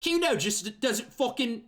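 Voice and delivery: put-on high Australian-sounding kid voice, breaking off; Kuno voice. Shown as a demonstration. Player demos his Kuno voice, high and Australian-sounding. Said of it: "I'm just trying my best to do Kuno".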